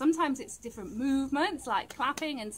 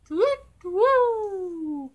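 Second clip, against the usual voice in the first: long, loud falling owl call; high echoey hoot; 5 semitones higher, 6 dB louder than usual. Pretend. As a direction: hoot like an owl and let it trail down. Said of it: "Hoot like an owl".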